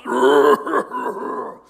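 loud, rattling mock cough; imitated smoker's cough. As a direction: hack like a heavy smoker. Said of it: "I could hear a smoker's cough".

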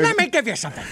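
creepy pizza lady voice. Retold or imitated.

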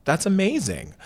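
high, slow, awed "amazing"; awed reply. Retold or imitated. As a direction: draw it out high in wonder. And I was like.

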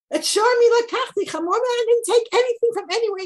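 high, loud, fast protest; indignant Moshe. Acted. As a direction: throw up your hands in protest. Voicing Shmuel's protest.

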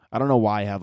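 ordinary talk

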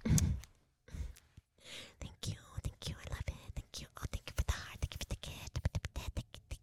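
breathy ASMR whisper. Pretend. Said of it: "sometimes you have to be like".

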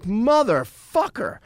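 high, drawn-out retold curse; exasperated curse. Man finding his hotel room trashed, exasperated. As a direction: stretch it out in outraged disbelief.

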